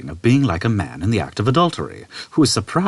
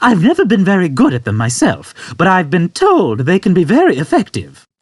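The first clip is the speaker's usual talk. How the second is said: put-on high, louder old man voice; 9 semitones higher, 6 dB louder than usual. Old man explaining to Arthur, high and unconcerned. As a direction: explain it matter-of-factly.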